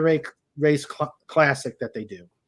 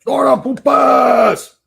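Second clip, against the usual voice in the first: loud, high, excited call-out; gleeful yell; excited shout; 11 semitones higher, 9 dB louder than usual. Real, not acted. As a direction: belt the shout-out to the chat.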